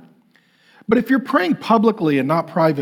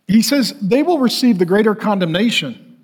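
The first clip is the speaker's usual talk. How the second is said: ordinary delivery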